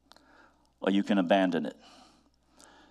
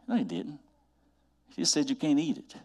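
usual speaking voice

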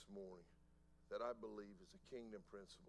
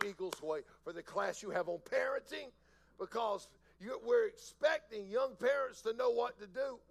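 full-force preaching; 10 semitones higher, 15 dB louder than usual. Acted. Pastor warning church about an unfathered world, preaching loudly. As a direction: preach it out to the back row.

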